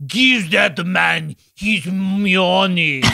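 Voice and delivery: cartoonish Russian accent, drawn out slowly; slow, overblown mock-Russian delivery; John Malkovich. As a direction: ham up an absurd Russian accent. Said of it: "his Russian accent is so ridiculously cartoonish".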